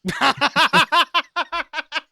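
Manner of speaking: a big high cackle; loud high laugh. Real, not acted.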